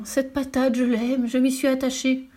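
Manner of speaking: mock-heartbroken director; tearful whining. A narrator's reading. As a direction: plead as if close to tears. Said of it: "dit-il en larmoyant".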